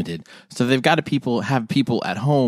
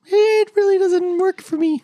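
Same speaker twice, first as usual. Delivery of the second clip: high squeaky mock voice. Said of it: "And he's like".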